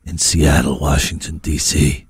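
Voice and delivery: very low American station-ident voice; mock late-night radio DJ. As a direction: intone it deep, pausing between phrases.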